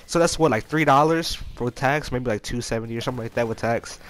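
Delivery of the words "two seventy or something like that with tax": ordinary talk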